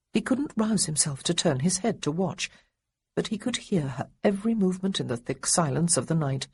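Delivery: ordinary delivery, nothing marked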